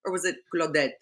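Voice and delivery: put-on thick French accent; lilting stage French accent. Shown as a demonstration. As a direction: show off a French accent, playfully grand. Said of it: "quite impressed with my French accent skills".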